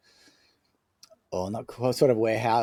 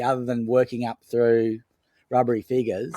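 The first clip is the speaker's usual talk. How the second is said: ordinary delivery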